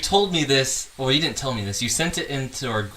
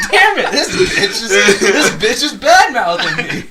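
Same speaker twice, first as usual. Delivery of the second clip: mock offended rapper; 8 semitones higher, 10 dB louder than usual. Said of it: "It's like".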